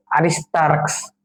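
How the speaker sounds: আরিস্তারাক্স drawn out slowly, each syllable clear; slow careful pronunciation. Shown as a demonstration. Teacher saying আরিস্তারাক্স slowly so students can repeat it. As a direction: spell the name out by sound for the class. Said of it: "মুখে মুখে উচ্চারণ করে".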